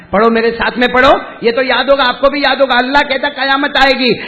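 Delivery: high-energy preacher's shout; preaching at full force. Acted. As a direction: rouse the congregation to join in. Urges crowd to recite.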